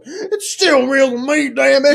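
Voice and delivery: a heartbroken fan yelling through tears; sobbing hillbilly outburst; crying wrestling fan. Pretend. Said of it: "I'll go like".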